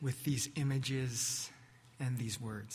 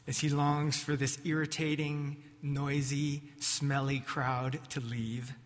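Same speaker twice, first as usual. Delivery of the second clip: ordinary talk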